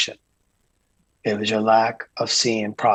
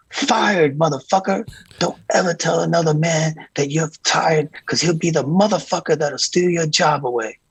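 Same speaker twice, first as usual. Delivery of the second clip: [name]'s lesson; 6 semitones higher than usual. Retold or imitated. Diddy warning his tour manager never to say tired, higher imitated rant. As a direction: hammer the lesson home in a pitched-up impression. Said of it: "And I go".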